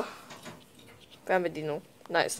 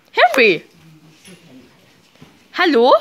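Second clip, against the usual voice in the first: yelling; 11 dB louder, slower than usual. Real, not acted. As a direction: yell his name impatiently. Calling Henry, no answer. (ungeduldig laut rufen)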